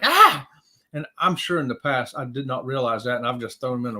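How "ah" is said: mock shocked yelp. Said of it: "I'm like".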